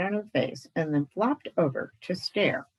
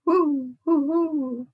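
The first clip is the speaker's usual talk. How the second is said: high, louder owl hooting; owl hoots; 10 semitones higher, 5 dB louder, much slower than usual. Acted. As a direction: hoot like a little screech owl. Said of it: "merely replied".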